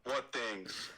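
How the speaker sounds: a hint of a brooding Anakin; lightly voiced young Jedi, reassuring. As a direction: Anakin in near-normal voice. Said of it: "my Anakin is pretty sick".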